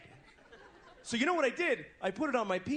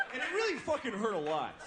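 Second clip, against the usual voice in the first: usual speaking voice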